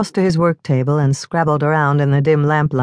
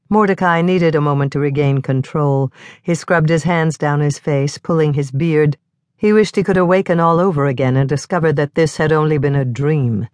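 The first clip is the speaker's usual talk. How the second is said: plain reading voice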